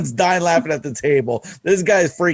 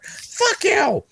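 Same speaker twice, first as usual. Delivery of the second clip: high, sharp imitated snap; angry retort. Teased coworker snapping at the guys, high and angry. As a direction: fire it back, offended. Annoyed coworker snaps back.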